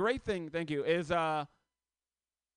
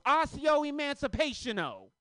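put-on kid casting a spell; 8 semitones higher, 5 dB louder than usual. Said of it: "He goes".

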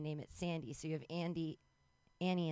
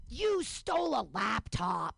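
slow, loud mock verdict; accusing child; 3 semitones higher, 5 dB louder, slower than usual. Twins returning to accuse tied-up nanny, slow loud mock accusation. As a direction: hammer out the accusation word by word.

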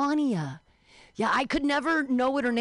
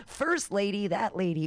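ordinary delivery

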